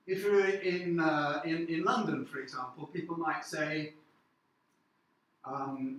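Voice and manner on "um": usual speaking voice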